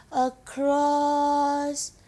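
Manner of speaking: "across" stretched very slowly, held long; drawn out. Acted. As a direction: draw out "across" as if reaching over the distance. Pointing far over the sea.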